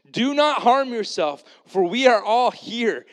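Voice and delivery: high, loud, slow urgent shout; loud cry. A narrator's reading. Paul shouting to the jailer, a loud slow cry. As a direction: cry out to stop him from harming himself. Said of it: "Paul cried with a loud voice".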